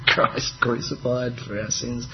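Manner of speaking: soft dawning realisation; realising, hushed. Pretend. Preacher voicing a listener's realisation, hushed and soft. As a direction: let it sink in, quietly amazed. Penny dropping realisation voiced.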